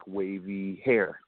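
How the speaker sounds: very slow, dreamy stretched words; savoring the memory of her hair, drawn out slowly. Real, not acted. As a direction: linger on "wavy hair" slowly as if picturing her.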